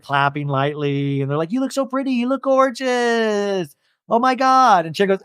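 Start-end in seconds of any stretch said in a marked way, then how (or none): 1.5-4.9 mock gushing. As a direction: imitate friends fawning over her. Friends gush over birthday girl.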